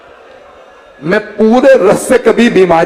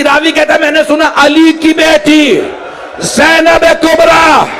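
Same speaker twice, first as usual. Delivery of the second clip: preaching at full force; 9 semitones higher than usual. Acted. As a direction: build the lament until you are crying it out at the top of your voice. Majlis recitation builds to full force. (मजलिस का पुरज़ोर मातमी बयान, ऊँची पुकार में)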